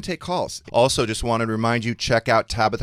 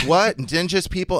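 put-on child confused; 7 semitones higher, 6 dB louder than usual.

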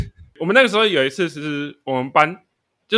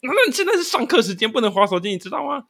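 surprised officer; 8 semitones higher than usual. Retold or imitated. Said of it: "好像也错愕了一下，就说".

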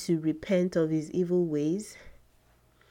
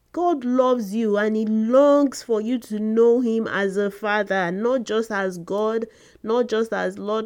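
raised, fervent sermon voice; earnest preaching; 4 semitones higher, 4 dB louder than usual. Acted. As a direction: press the point home to the congregation. Preaches at rising force.

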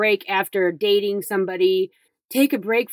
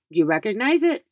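ordinary delivery

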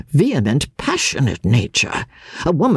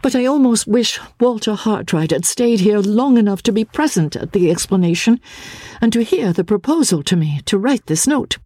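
higher, a lightly voiced earnest young woman; Miss Halcombe; 7 semitones higher than usual. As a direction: answer as Marian, quick and slightly troubled. Miss Halcombe wishes Hartright stayed.

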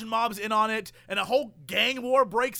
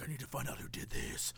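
low, hushed vow of revenge; grim movie avenger; 7 semitones lower, 15 dB quieter than usual. Acted. Father in full rage mode vows revenge, hushed and grim. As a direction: act a seething hero, very soft and low.

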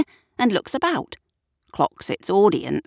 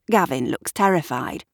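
plain reading voice

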